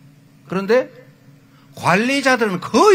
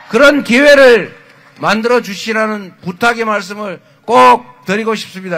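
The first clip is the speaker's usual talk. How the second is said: rally shout; 2 semitones higher, 9 dB louder than usual. Real, not acted. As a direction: hammer home the plea at the climax. Rally climax, appeal to voters.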